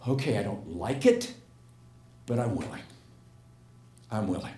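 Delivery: soft, low, imitated surrender; retold quiet acceptance. Woman finally facing her spider fear, retold in quiet acceptance. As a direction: give in gently, pausing before each admission. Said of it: "there was a certain level of peace".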